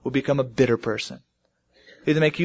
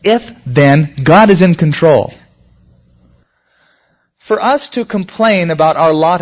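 emphatic preaching; 3 semitones higher, 7 dB louder than usual. Acted.

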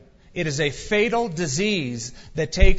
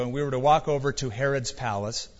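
normal talking voice

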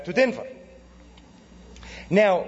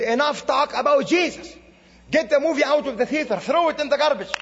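higher, retold angry command; angry leader; 6 semitones higher than usual. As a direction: bark it like an outraged cleric.

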